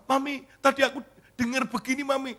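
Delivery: put-on high, insistent child voice; high-pitched childlike eagerness; child telling mother. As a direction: imitate a kid reporting to Mami. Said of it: "kemudian dia bilang gini".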